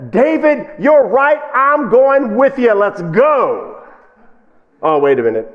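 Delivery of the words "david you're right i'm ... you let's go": mock enthusiasm. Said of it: "And then Saul says".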